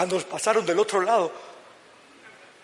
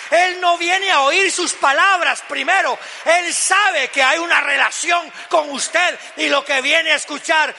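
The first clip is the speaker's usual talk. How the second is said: raised, high-pitched impassioned sermon delivery; full-force preaching; 8 semitones higher, 8 dB louder than usual. Real, not acted. Preacher rousing the congregation about God hearing their voice, fervent. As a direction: build to loud, driving proclamation.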